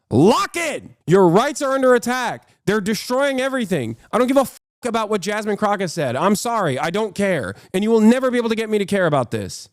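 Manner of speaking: ordinary talk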